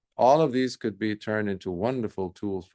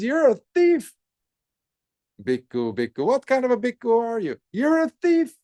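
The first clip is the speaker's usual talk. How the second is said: high, loud, fast scolding voice; deva shrieking at the monk; 14 semitones higher, 7 dB louder than usual. Acted. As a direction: point the finger and shrill out the charge.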